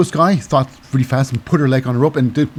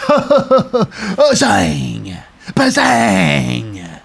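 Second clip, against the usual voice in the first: gleeful exclamation; 7 semitones higher, much slower than usual. Real, not acted. Laughing exclamation at the finish.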